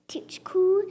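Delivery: breathy puffs of air on toot, cool; blowing to cool. Pretend. Blowing on hot potato.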